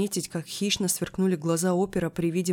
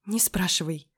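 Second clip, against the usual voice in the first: half-whispered squeak; small squeak. A narrator's reading. Friend dodges the question, squeaking. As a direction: squeak it out, barely voiced. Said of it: "пискнула Лиля".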